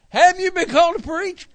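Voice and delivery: high, loud alarmed wife's voice; wife's alarmed echo. Retold or imitated. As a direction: echo it back in shock, voice jumping up. Wife echoes in alarm.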